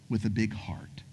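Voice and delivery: hushed reverent whisper. Acted. Preacher to congregation, tender, in a whisper. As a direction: breathe it out tenderly. Sermon climax.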